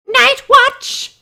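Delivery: very high squealed name; mock squeal. Streamer squealing the pony's name.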